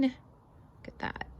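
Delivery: slow soft whisper; hushed look at the picture. Real, not acted. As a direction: breathe it out in wonder.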